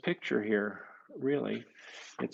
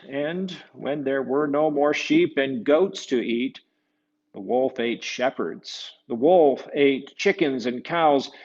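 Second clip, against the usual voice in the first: louder, slow, dramatic read-aloud; storybook narration building suspense; 7 dB louder, slower than usual. A narrator's reading. Telling children about the fierce wolf, dramatic and slow. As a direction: tell the wolf's menace with big emphasis.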